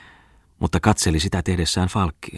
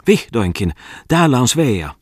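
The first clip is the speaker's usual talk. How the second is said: high, loud, shrill scolding; shrill snapping; 6 semitones higher, 5 dB louder than usual. A narrator's reading. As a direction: snap it out high and sharp. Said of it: "tiuskahti hän kimeästi". (kimeä, äkäinen tiuskaisu)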